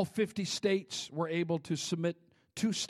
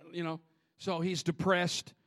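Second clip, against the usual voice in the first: usual speaking voice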